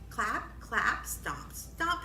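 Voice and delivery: normal talking voice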